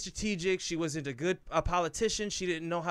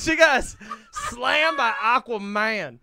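excited; 5 semitones higher, 8 dB louder than usual. Real, not acted. Excited outburst about the scene.

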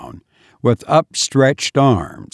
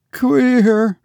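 very high, quavery single word; with a shudder; 12 semitones higher than usual. A narrator's reading. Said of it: "replied Cordelia with a shudder".

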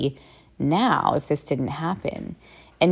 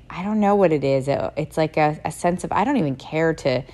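ordinary delivery

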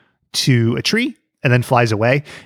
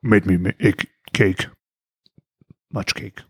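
disguised witness voice; 5 semitones lower, 3 dB quieter, slower than usual. Pretend. Joins the witness-protection bit.

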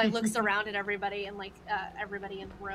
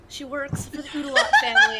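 laughing through line; 7 semitones higher, 11 dB louder than usual. Real, not acted. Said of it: "she's like".

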